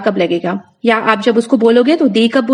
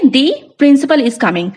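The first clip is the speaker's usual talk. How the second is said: The said as "dee" and leaned on, slow delivery; teacher shows emphasis by stressing The; much slower than usual. Shown as a demonstration. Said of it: "जोर देके कोई चीज बोलनी है".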